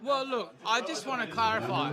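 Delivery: loud, high-pitched declaiming; shouting. Real, not acted. Comic launching a rant at the camera, shouting. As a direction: shout it out like a protest speech.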